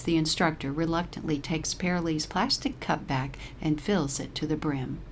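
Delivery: plain reading voice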